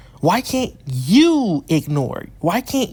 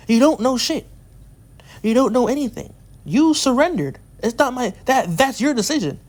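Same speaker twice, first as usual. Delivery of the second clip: indignant, higher-pitched telling-off; heated rebuke; 6 semitones higher than usual. Real, not acted. Ranting at an online bully who surrendered, indignant. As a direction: push back hard, voice raised in pitch.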